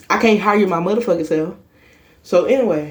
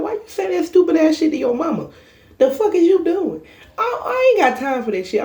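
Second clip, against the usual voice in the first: laughing scold; 11 semitones higher than usual. Retold or imitated. Scolding him over his mama.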